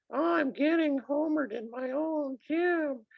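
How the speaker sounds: high, squeaky, whining coach voice; mock a whiny coach complaining to the ref; squeaky whiner. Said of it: "it's so squeaky and weird".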